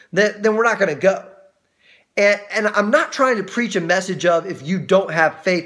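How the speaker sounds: ordinary talk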